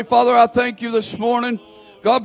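normal talking voice

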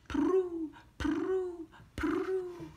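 high cooing prue; pigeon coo. A narrator's reading. Narrator voicing pigeons asking to be fed. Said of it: "nodding their heads".

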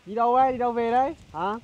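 high, loud call to passers-by. Real, not acted. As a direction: shout a friendly question across the way. Calls out to passers-by.

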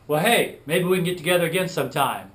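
David calling out eagerly to his new friend; eager invite. Retold or imitated. Friend wanting to meet again, eager and loud.

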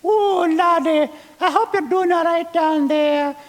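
put-on a fond old Scottish mother on the phone; sweet Scottish mother. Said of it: "His sweet mother calls from Aberdeen".

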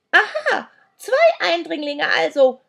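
high, loud accusing cry; high-pitched accusation; yelling crone. A narrator's reading. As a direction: shout it like an indignant old creature. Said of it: "schrie sie".

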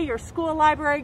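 ordinary delivery

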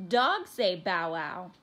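loud, slow, pointed correction; teasing correction. A narrator's reading. Brother correcting Chico, mildly teasing. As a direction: spell it out slowly for a little brother.

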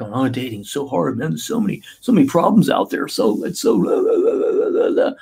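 mock complaining client, leaping up to a squeaky pitch on the blahs; whiny dater. Dating coach imitating a whiny client, squeaky mock whine. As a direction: whine it like a hopeless single guy. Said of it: "people are like".

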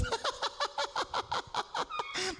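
put-on long, high cackle as old Sarah; pulsing mock old-woman laughter; incredulous acted laugh. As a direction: laugh in disbelief, high-pitched. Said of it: "사라가 너무 말이 안 돼서".

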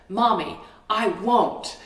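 son slamming his hand back in defiance; defiant child shout. Retold or imitated. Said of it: "slammed it on that same counter and said".

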